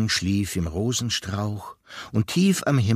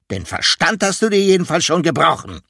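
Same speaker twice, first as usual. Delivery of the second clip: higher, louder, fast scornful jab; contemptuous; 6 semitones higher, 8 dB louder, faster than usual. A narrator's reading. Elephant mocking the climber's mind with contempt. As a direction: sneer it out quickly. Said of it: "sagte er verächtlich".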